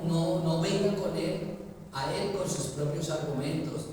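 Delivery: ordinary talk, nothing marked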